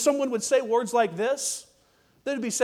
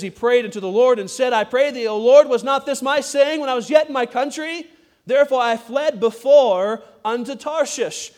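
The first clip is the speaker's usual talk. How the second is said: loud, higher scripture reading, slowing at the end; 4 semitones higher, 7 dB louder than usual. Acted. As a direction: proclaim the verse to the congregation. Reads Jonah's prayer from Bible.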